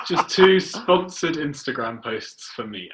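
slow, amused, laughing through the words. Real, not acted. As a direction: chuckle while you joke back. Joking reply, laughing through words.

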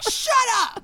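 high mock snap; mock snap at dog. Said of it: "And then you're like".

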